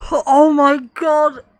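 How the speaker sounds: loud, slow drawn-out oh my god; real dismay stretched out; stunned groan. Real, not acted. Player reeling after the death, slow loud groan.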